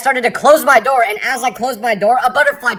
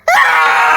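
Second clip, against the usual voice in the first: shrieking scream, much higher; short high scream; 6 dB louder than usual. Acted. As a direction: yelp in fright. Scream at British ghost.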